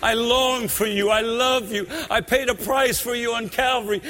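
impassioned revival preacher pleading with listeners; preaching at full force. Acted. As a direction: build the altar call with fervour. Preacher rising into altar call.

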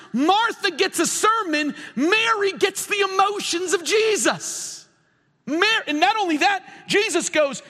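high-pitched, driving sermon delivery; impassioned preaching. Real, not acted. Preaches at full force.

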